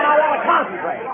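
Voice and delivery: ordinary talk, nothing marked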